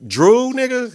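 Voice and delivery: high and loud, scoffing at the name; incredulous shout. Real, not acted. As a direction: shout the name like it's a joke. Host mocks Drew going after Noma.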